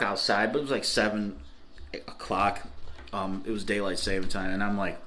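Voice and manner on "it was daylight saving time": usual speaking voice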